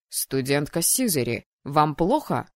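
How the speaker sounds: low, restrained voice of a dean; lightly voiced low official; stern dean's inquiry. A narrator's reading. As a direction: question a student with cool authority. Dean questions the howling student.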